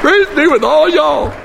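high, loud Southern proclamation; exultant. Real, not acted. Preacher repeating the verse to laughing congregation, loud and exultant. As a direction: proclaim it with soaring glee.

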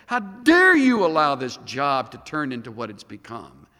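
building angry how dare you; mock angry protest. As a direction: voice the congregation's fury, hammed-up. Voicing people shaking fists.

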